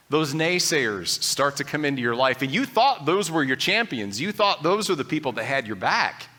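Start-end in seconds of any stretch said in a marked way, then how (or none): none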